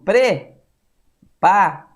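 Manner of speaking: "preparatório" in slow separate syllables, with long pauses; separado em sílabas. Shown as a demonstration. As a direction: chop "preparatório" into pre, pa, ra, tó, rio. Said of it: "separação silábica". ("preparatório" sílaba por sílaba, com pausas longas)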